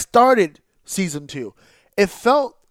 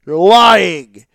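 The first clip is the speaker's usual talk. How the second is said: much louder repeat, hammering lying; louder accusation; 12 dB louder than usual. Real, not acted. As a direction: shout the accusation back harder.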